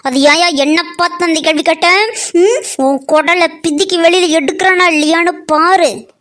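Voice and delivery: furious shouting. Acted. Woman yelling at the polling officer, furious. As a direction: scream at him in fury.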